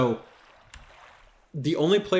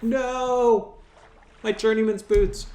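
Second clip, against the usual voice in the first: drawn-out, high-pitched cry of loss; anguished wail; 9 semitones higher, much slower than usual. Retold or imitated. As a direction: wail in mock despair over lost loot. Acting out his anguish over loot.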